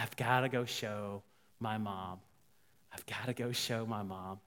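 low, soft, halting; acted-out tender resolve; quiet, moved. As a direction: say it hushed, overwhelmed. Overwhelmed woman wants her mom.

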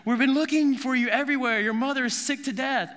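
high, loud, fast frantic call; panicked parents finally finding the lost boy. Acted. Parents who searched three days, frantic and loud. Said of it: "they find him and said".

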